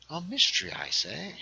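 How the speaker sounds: hammed-up British exclamation; terrible British accent. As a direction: put on a bad British accent. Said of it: "In my terrible British accent".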